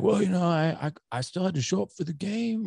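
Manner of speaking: high, strange voice, a little fast; Kevin Durant impression. Teammates scold him, he answers in a high put-on voice. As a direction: play the sheepish star making excuses. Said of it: "That's a pretty good impression".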